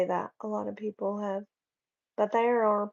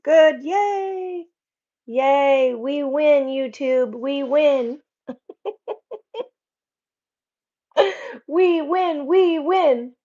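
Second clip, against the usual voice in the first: excited cheer; 6 semitones higher, 8 dB louder, much slower than usual. Real, not acted. Cheering that comment not banned.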